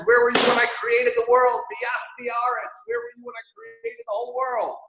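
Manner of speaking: high, fast, thundering where were you; God demanding an answer of Iyov; booming divine challenge. Retold or imitated. Hashem answers Iyov from the storm.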